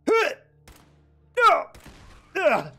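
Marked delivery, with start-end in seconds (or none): 2.4-2.7 pained yelp. Real, not acted. Pain screams in combat.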